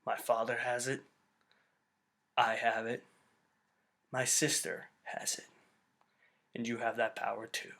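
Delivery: hushed, low, solemn impression with long pauses; very soft, low movie-line delivery; Luke. Jedi revealing the family secret, hushed impression. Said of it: "waiting for him to go".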